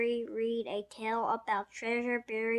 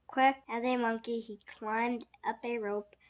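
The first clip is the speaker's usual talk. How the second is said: ordinary delivery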